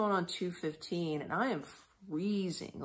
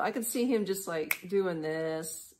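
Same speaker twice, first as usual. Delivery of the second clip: ordinary delivery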